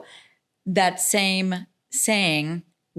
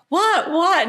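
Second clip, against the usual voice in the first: much higher startled what; shocked what; 11 semitones higher, 3 dB louder than usual. Retold or imitated. Shop owners reacting shocked to the scolding lady. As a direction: caught off guard.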